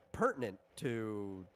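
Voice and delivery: ordinary talk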